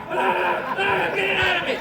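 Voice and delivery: high, loud hammed-up pioneer birth cry; mock labor scream. Pioneer woman giving birth in a wagon, mock labor scream. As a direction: wail like biting down on an arrow. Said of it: "gave birth in the eighteen hundreds".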